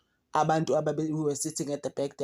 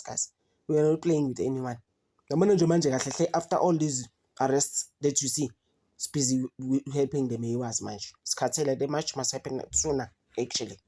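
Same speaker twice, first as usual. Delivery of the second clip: usual speaking voice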